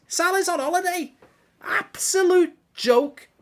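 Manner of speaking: hammed-up outrage in a borrowed singer's voice. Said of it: "Give us a [name] impression".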